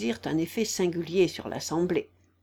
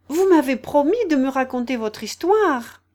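higher, louder Alice reminding a promise; lightly voiced storybook girl, pitched up; raised, insistent storybook voice; Alice, eager child; 6 semitones higher, 7 dB louder than usual. A narrator's reading.

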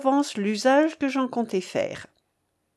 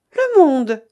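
louder, higher cut-in; interrupting exclamation; 4 semitones higher, 8 dB louder than usual. A narrator's reading. Daughter cutting off her father's argument, loud interrupting exclamation. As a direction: break in sharply on the other speaker. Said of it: "interrompit Edmé".

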